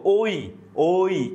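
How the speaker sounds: ওই drawn out very slowly, each sound separate; slow teacher's sounding-out of ওই. Shown as a demonstration. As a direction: stretch ওই so the students hear both vowels. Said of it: "একটু উচ্চারণ করলে কিন্তু".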